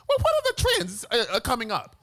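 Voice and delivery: high, mocking mimicry of a trend-seeker; squeaky-high pestering question, sent up with scorn; mocking questioner. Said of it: "Do not ask me".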